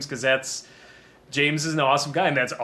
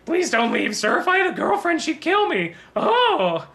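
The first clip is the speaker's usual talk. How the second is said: very high, louder squeaky nerd; wormy nerd voice; 5 dB louder than usual. Retold or imitated. Quoting the Simpsons nerd, a very high squeaky plea. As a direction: plead like a desperate little nerd. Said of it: "that wormy nerd from, um, The Simpsons".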